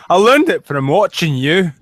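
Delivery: loud, slow put-on Scots; exaggerated Scottish brogue; Scottish accent. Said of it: "I gave Dankula a Scottish accent".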